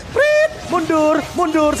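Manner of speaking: drawn-out urgent call. Real, not acted. Caster urging retreat.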